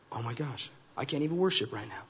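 soft, low put-out aside; acted exasperation under the breath; mock dismay. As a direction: quietly grumble in mock dismay. Acted dismay at seeing ex-boyfriend.

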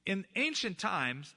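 normal talking voice

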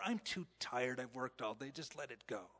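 fading into a tired, soft mumble; weary sigh. Retold or imitated. Worn-out parent trailing off after a long day.

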